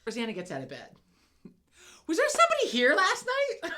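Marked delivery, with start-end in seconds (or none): 2.1-3.7 high, loud, laughing imitation of a roommate; mock groggy roommate. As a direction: squeal out the roommate's question while laughing. Imitating Rosanna's question.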